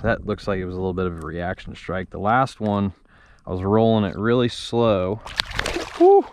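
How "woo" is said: normal talking voice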